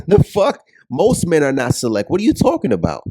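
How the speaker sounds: loud, high-pitched disbelief with a laugh; incredulous scoffing. Real, not acted. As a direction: scoff loudly at an absurd claim. Scoffing at the viewer's comment.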